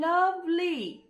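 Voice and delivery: লাভলি drawn out as লাভ-লি; syllable by syllable. Shown as a demonstration. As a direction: show the two syllables of লাভলি. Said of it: "দুটো syllable রয়েছে লাভলিতে". (লাভলি দুই ভাগে টেনে বলা)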